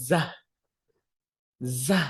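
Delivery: "জা" as a buzzing "zaa"; short, thin z sound in "জা"; thin Arabic zaa. Shown as a demonstration. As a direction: make "জা" thin, with z not j. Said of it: "যাটা হচ্ছে একটু চিকন করে".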